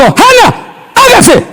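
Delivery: high, ringing divine command; voice of God commanding. Acted. Preacher voicing God's command at creation. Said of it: "Dios no dijo".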